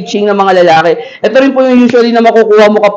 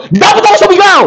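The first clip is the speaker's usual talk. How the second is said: sudden forced shout; 10 semitones higher, much faster than usual. Retold or imitated. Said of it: "bigla ka na lang".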